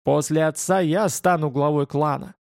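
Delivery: ordinary delivery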